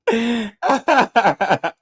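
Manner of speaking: a high squealing laugh of joy; high delighted laugh. Real, not acted. Streamer thrilled about the bet, high laugh.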